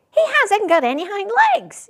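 high, loud, fast taunting call. A narrator's reading. Wild rabbit calling out the discovery, high, loud and quick. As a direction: call it out to the others. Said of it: "he called out".

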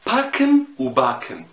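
باركن وباكن said high, loud and slow, the R then without it; contrast parken and packen. Shown as a demonstration. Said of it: "ازاي؟". (باركن وباكن بصوت عالي وببطء)

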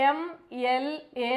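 എം, എൽ, എ spoken as separate letters with pauses. Shown as a demonstration. Teacher showing students how MLA is pronounced. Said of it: "നമ്മളത് pronounce ചെയ്യുന്നത് എങ്ങനെയാണ്?".

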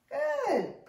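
put-on high, bright "good" for a child; big teacher praise; excited praise. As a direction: cheer the answer on.

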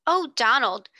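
alarmed exclaim. Acted. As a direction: call out to her brother in alarm. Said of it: "Janet exclaimed alarmedly".

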